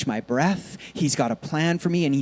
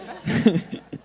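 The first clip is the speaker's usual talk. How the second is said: breathy giggle; 3 dB quieter than usual. Real, not acted. Laughing at own voice.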